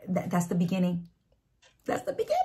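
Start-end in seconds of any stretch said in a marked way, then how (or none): 1.8-2.4 choked up, voice cracks high; real tears catching the words; voice breaking. Real, not acted. Voice breaks with emotion.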